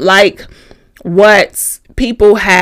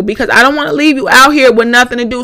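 ordinary talk, nothing marked

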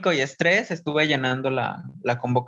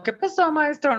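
students asking; 13 semitones higher than usual. Retold or imitated. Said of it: "me preguntaron como".

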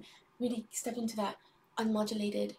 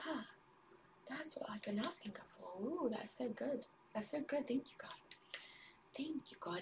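whispering; 2 semitones higher, 10 dB quieter than usual. Real, not acted. Whispered thanks to God.

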